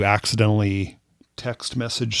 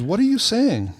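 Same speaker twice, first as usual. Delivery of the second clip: high-pitched mock bewilderment; baffled stranger; 12 semitones higher than usual. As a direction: question it in a raised put-on voice. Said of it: "She goes".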